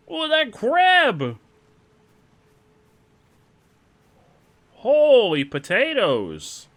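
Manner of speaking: high, slow, loud shrieks; shrieking. Real, not acted. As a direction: shriek in alarm at the crab. Crab attacks.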